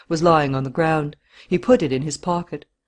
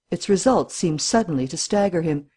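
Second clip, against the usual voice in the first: ordinary delivery, nothing marked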